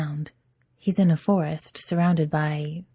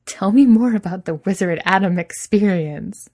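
laughing; 4 semitones higher, 8 dB louder than usual. Acted. Adam still laughing, teasing.

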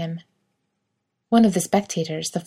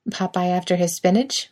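ordinary delivery

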